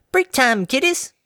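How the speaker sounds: put-on high, bright chirp of an elderly mare calling out; chirpy old granny. A narrator's reading. Granny calling the workers to a break, high and chirpy. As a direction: call the kids over, high and chirpy. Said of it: "she chirped".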